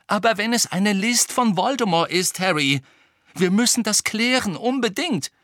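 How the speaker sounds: urgent insistence. A narrator's reading. Hermine warning Harry of a trap, insistent. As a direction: insist on checking.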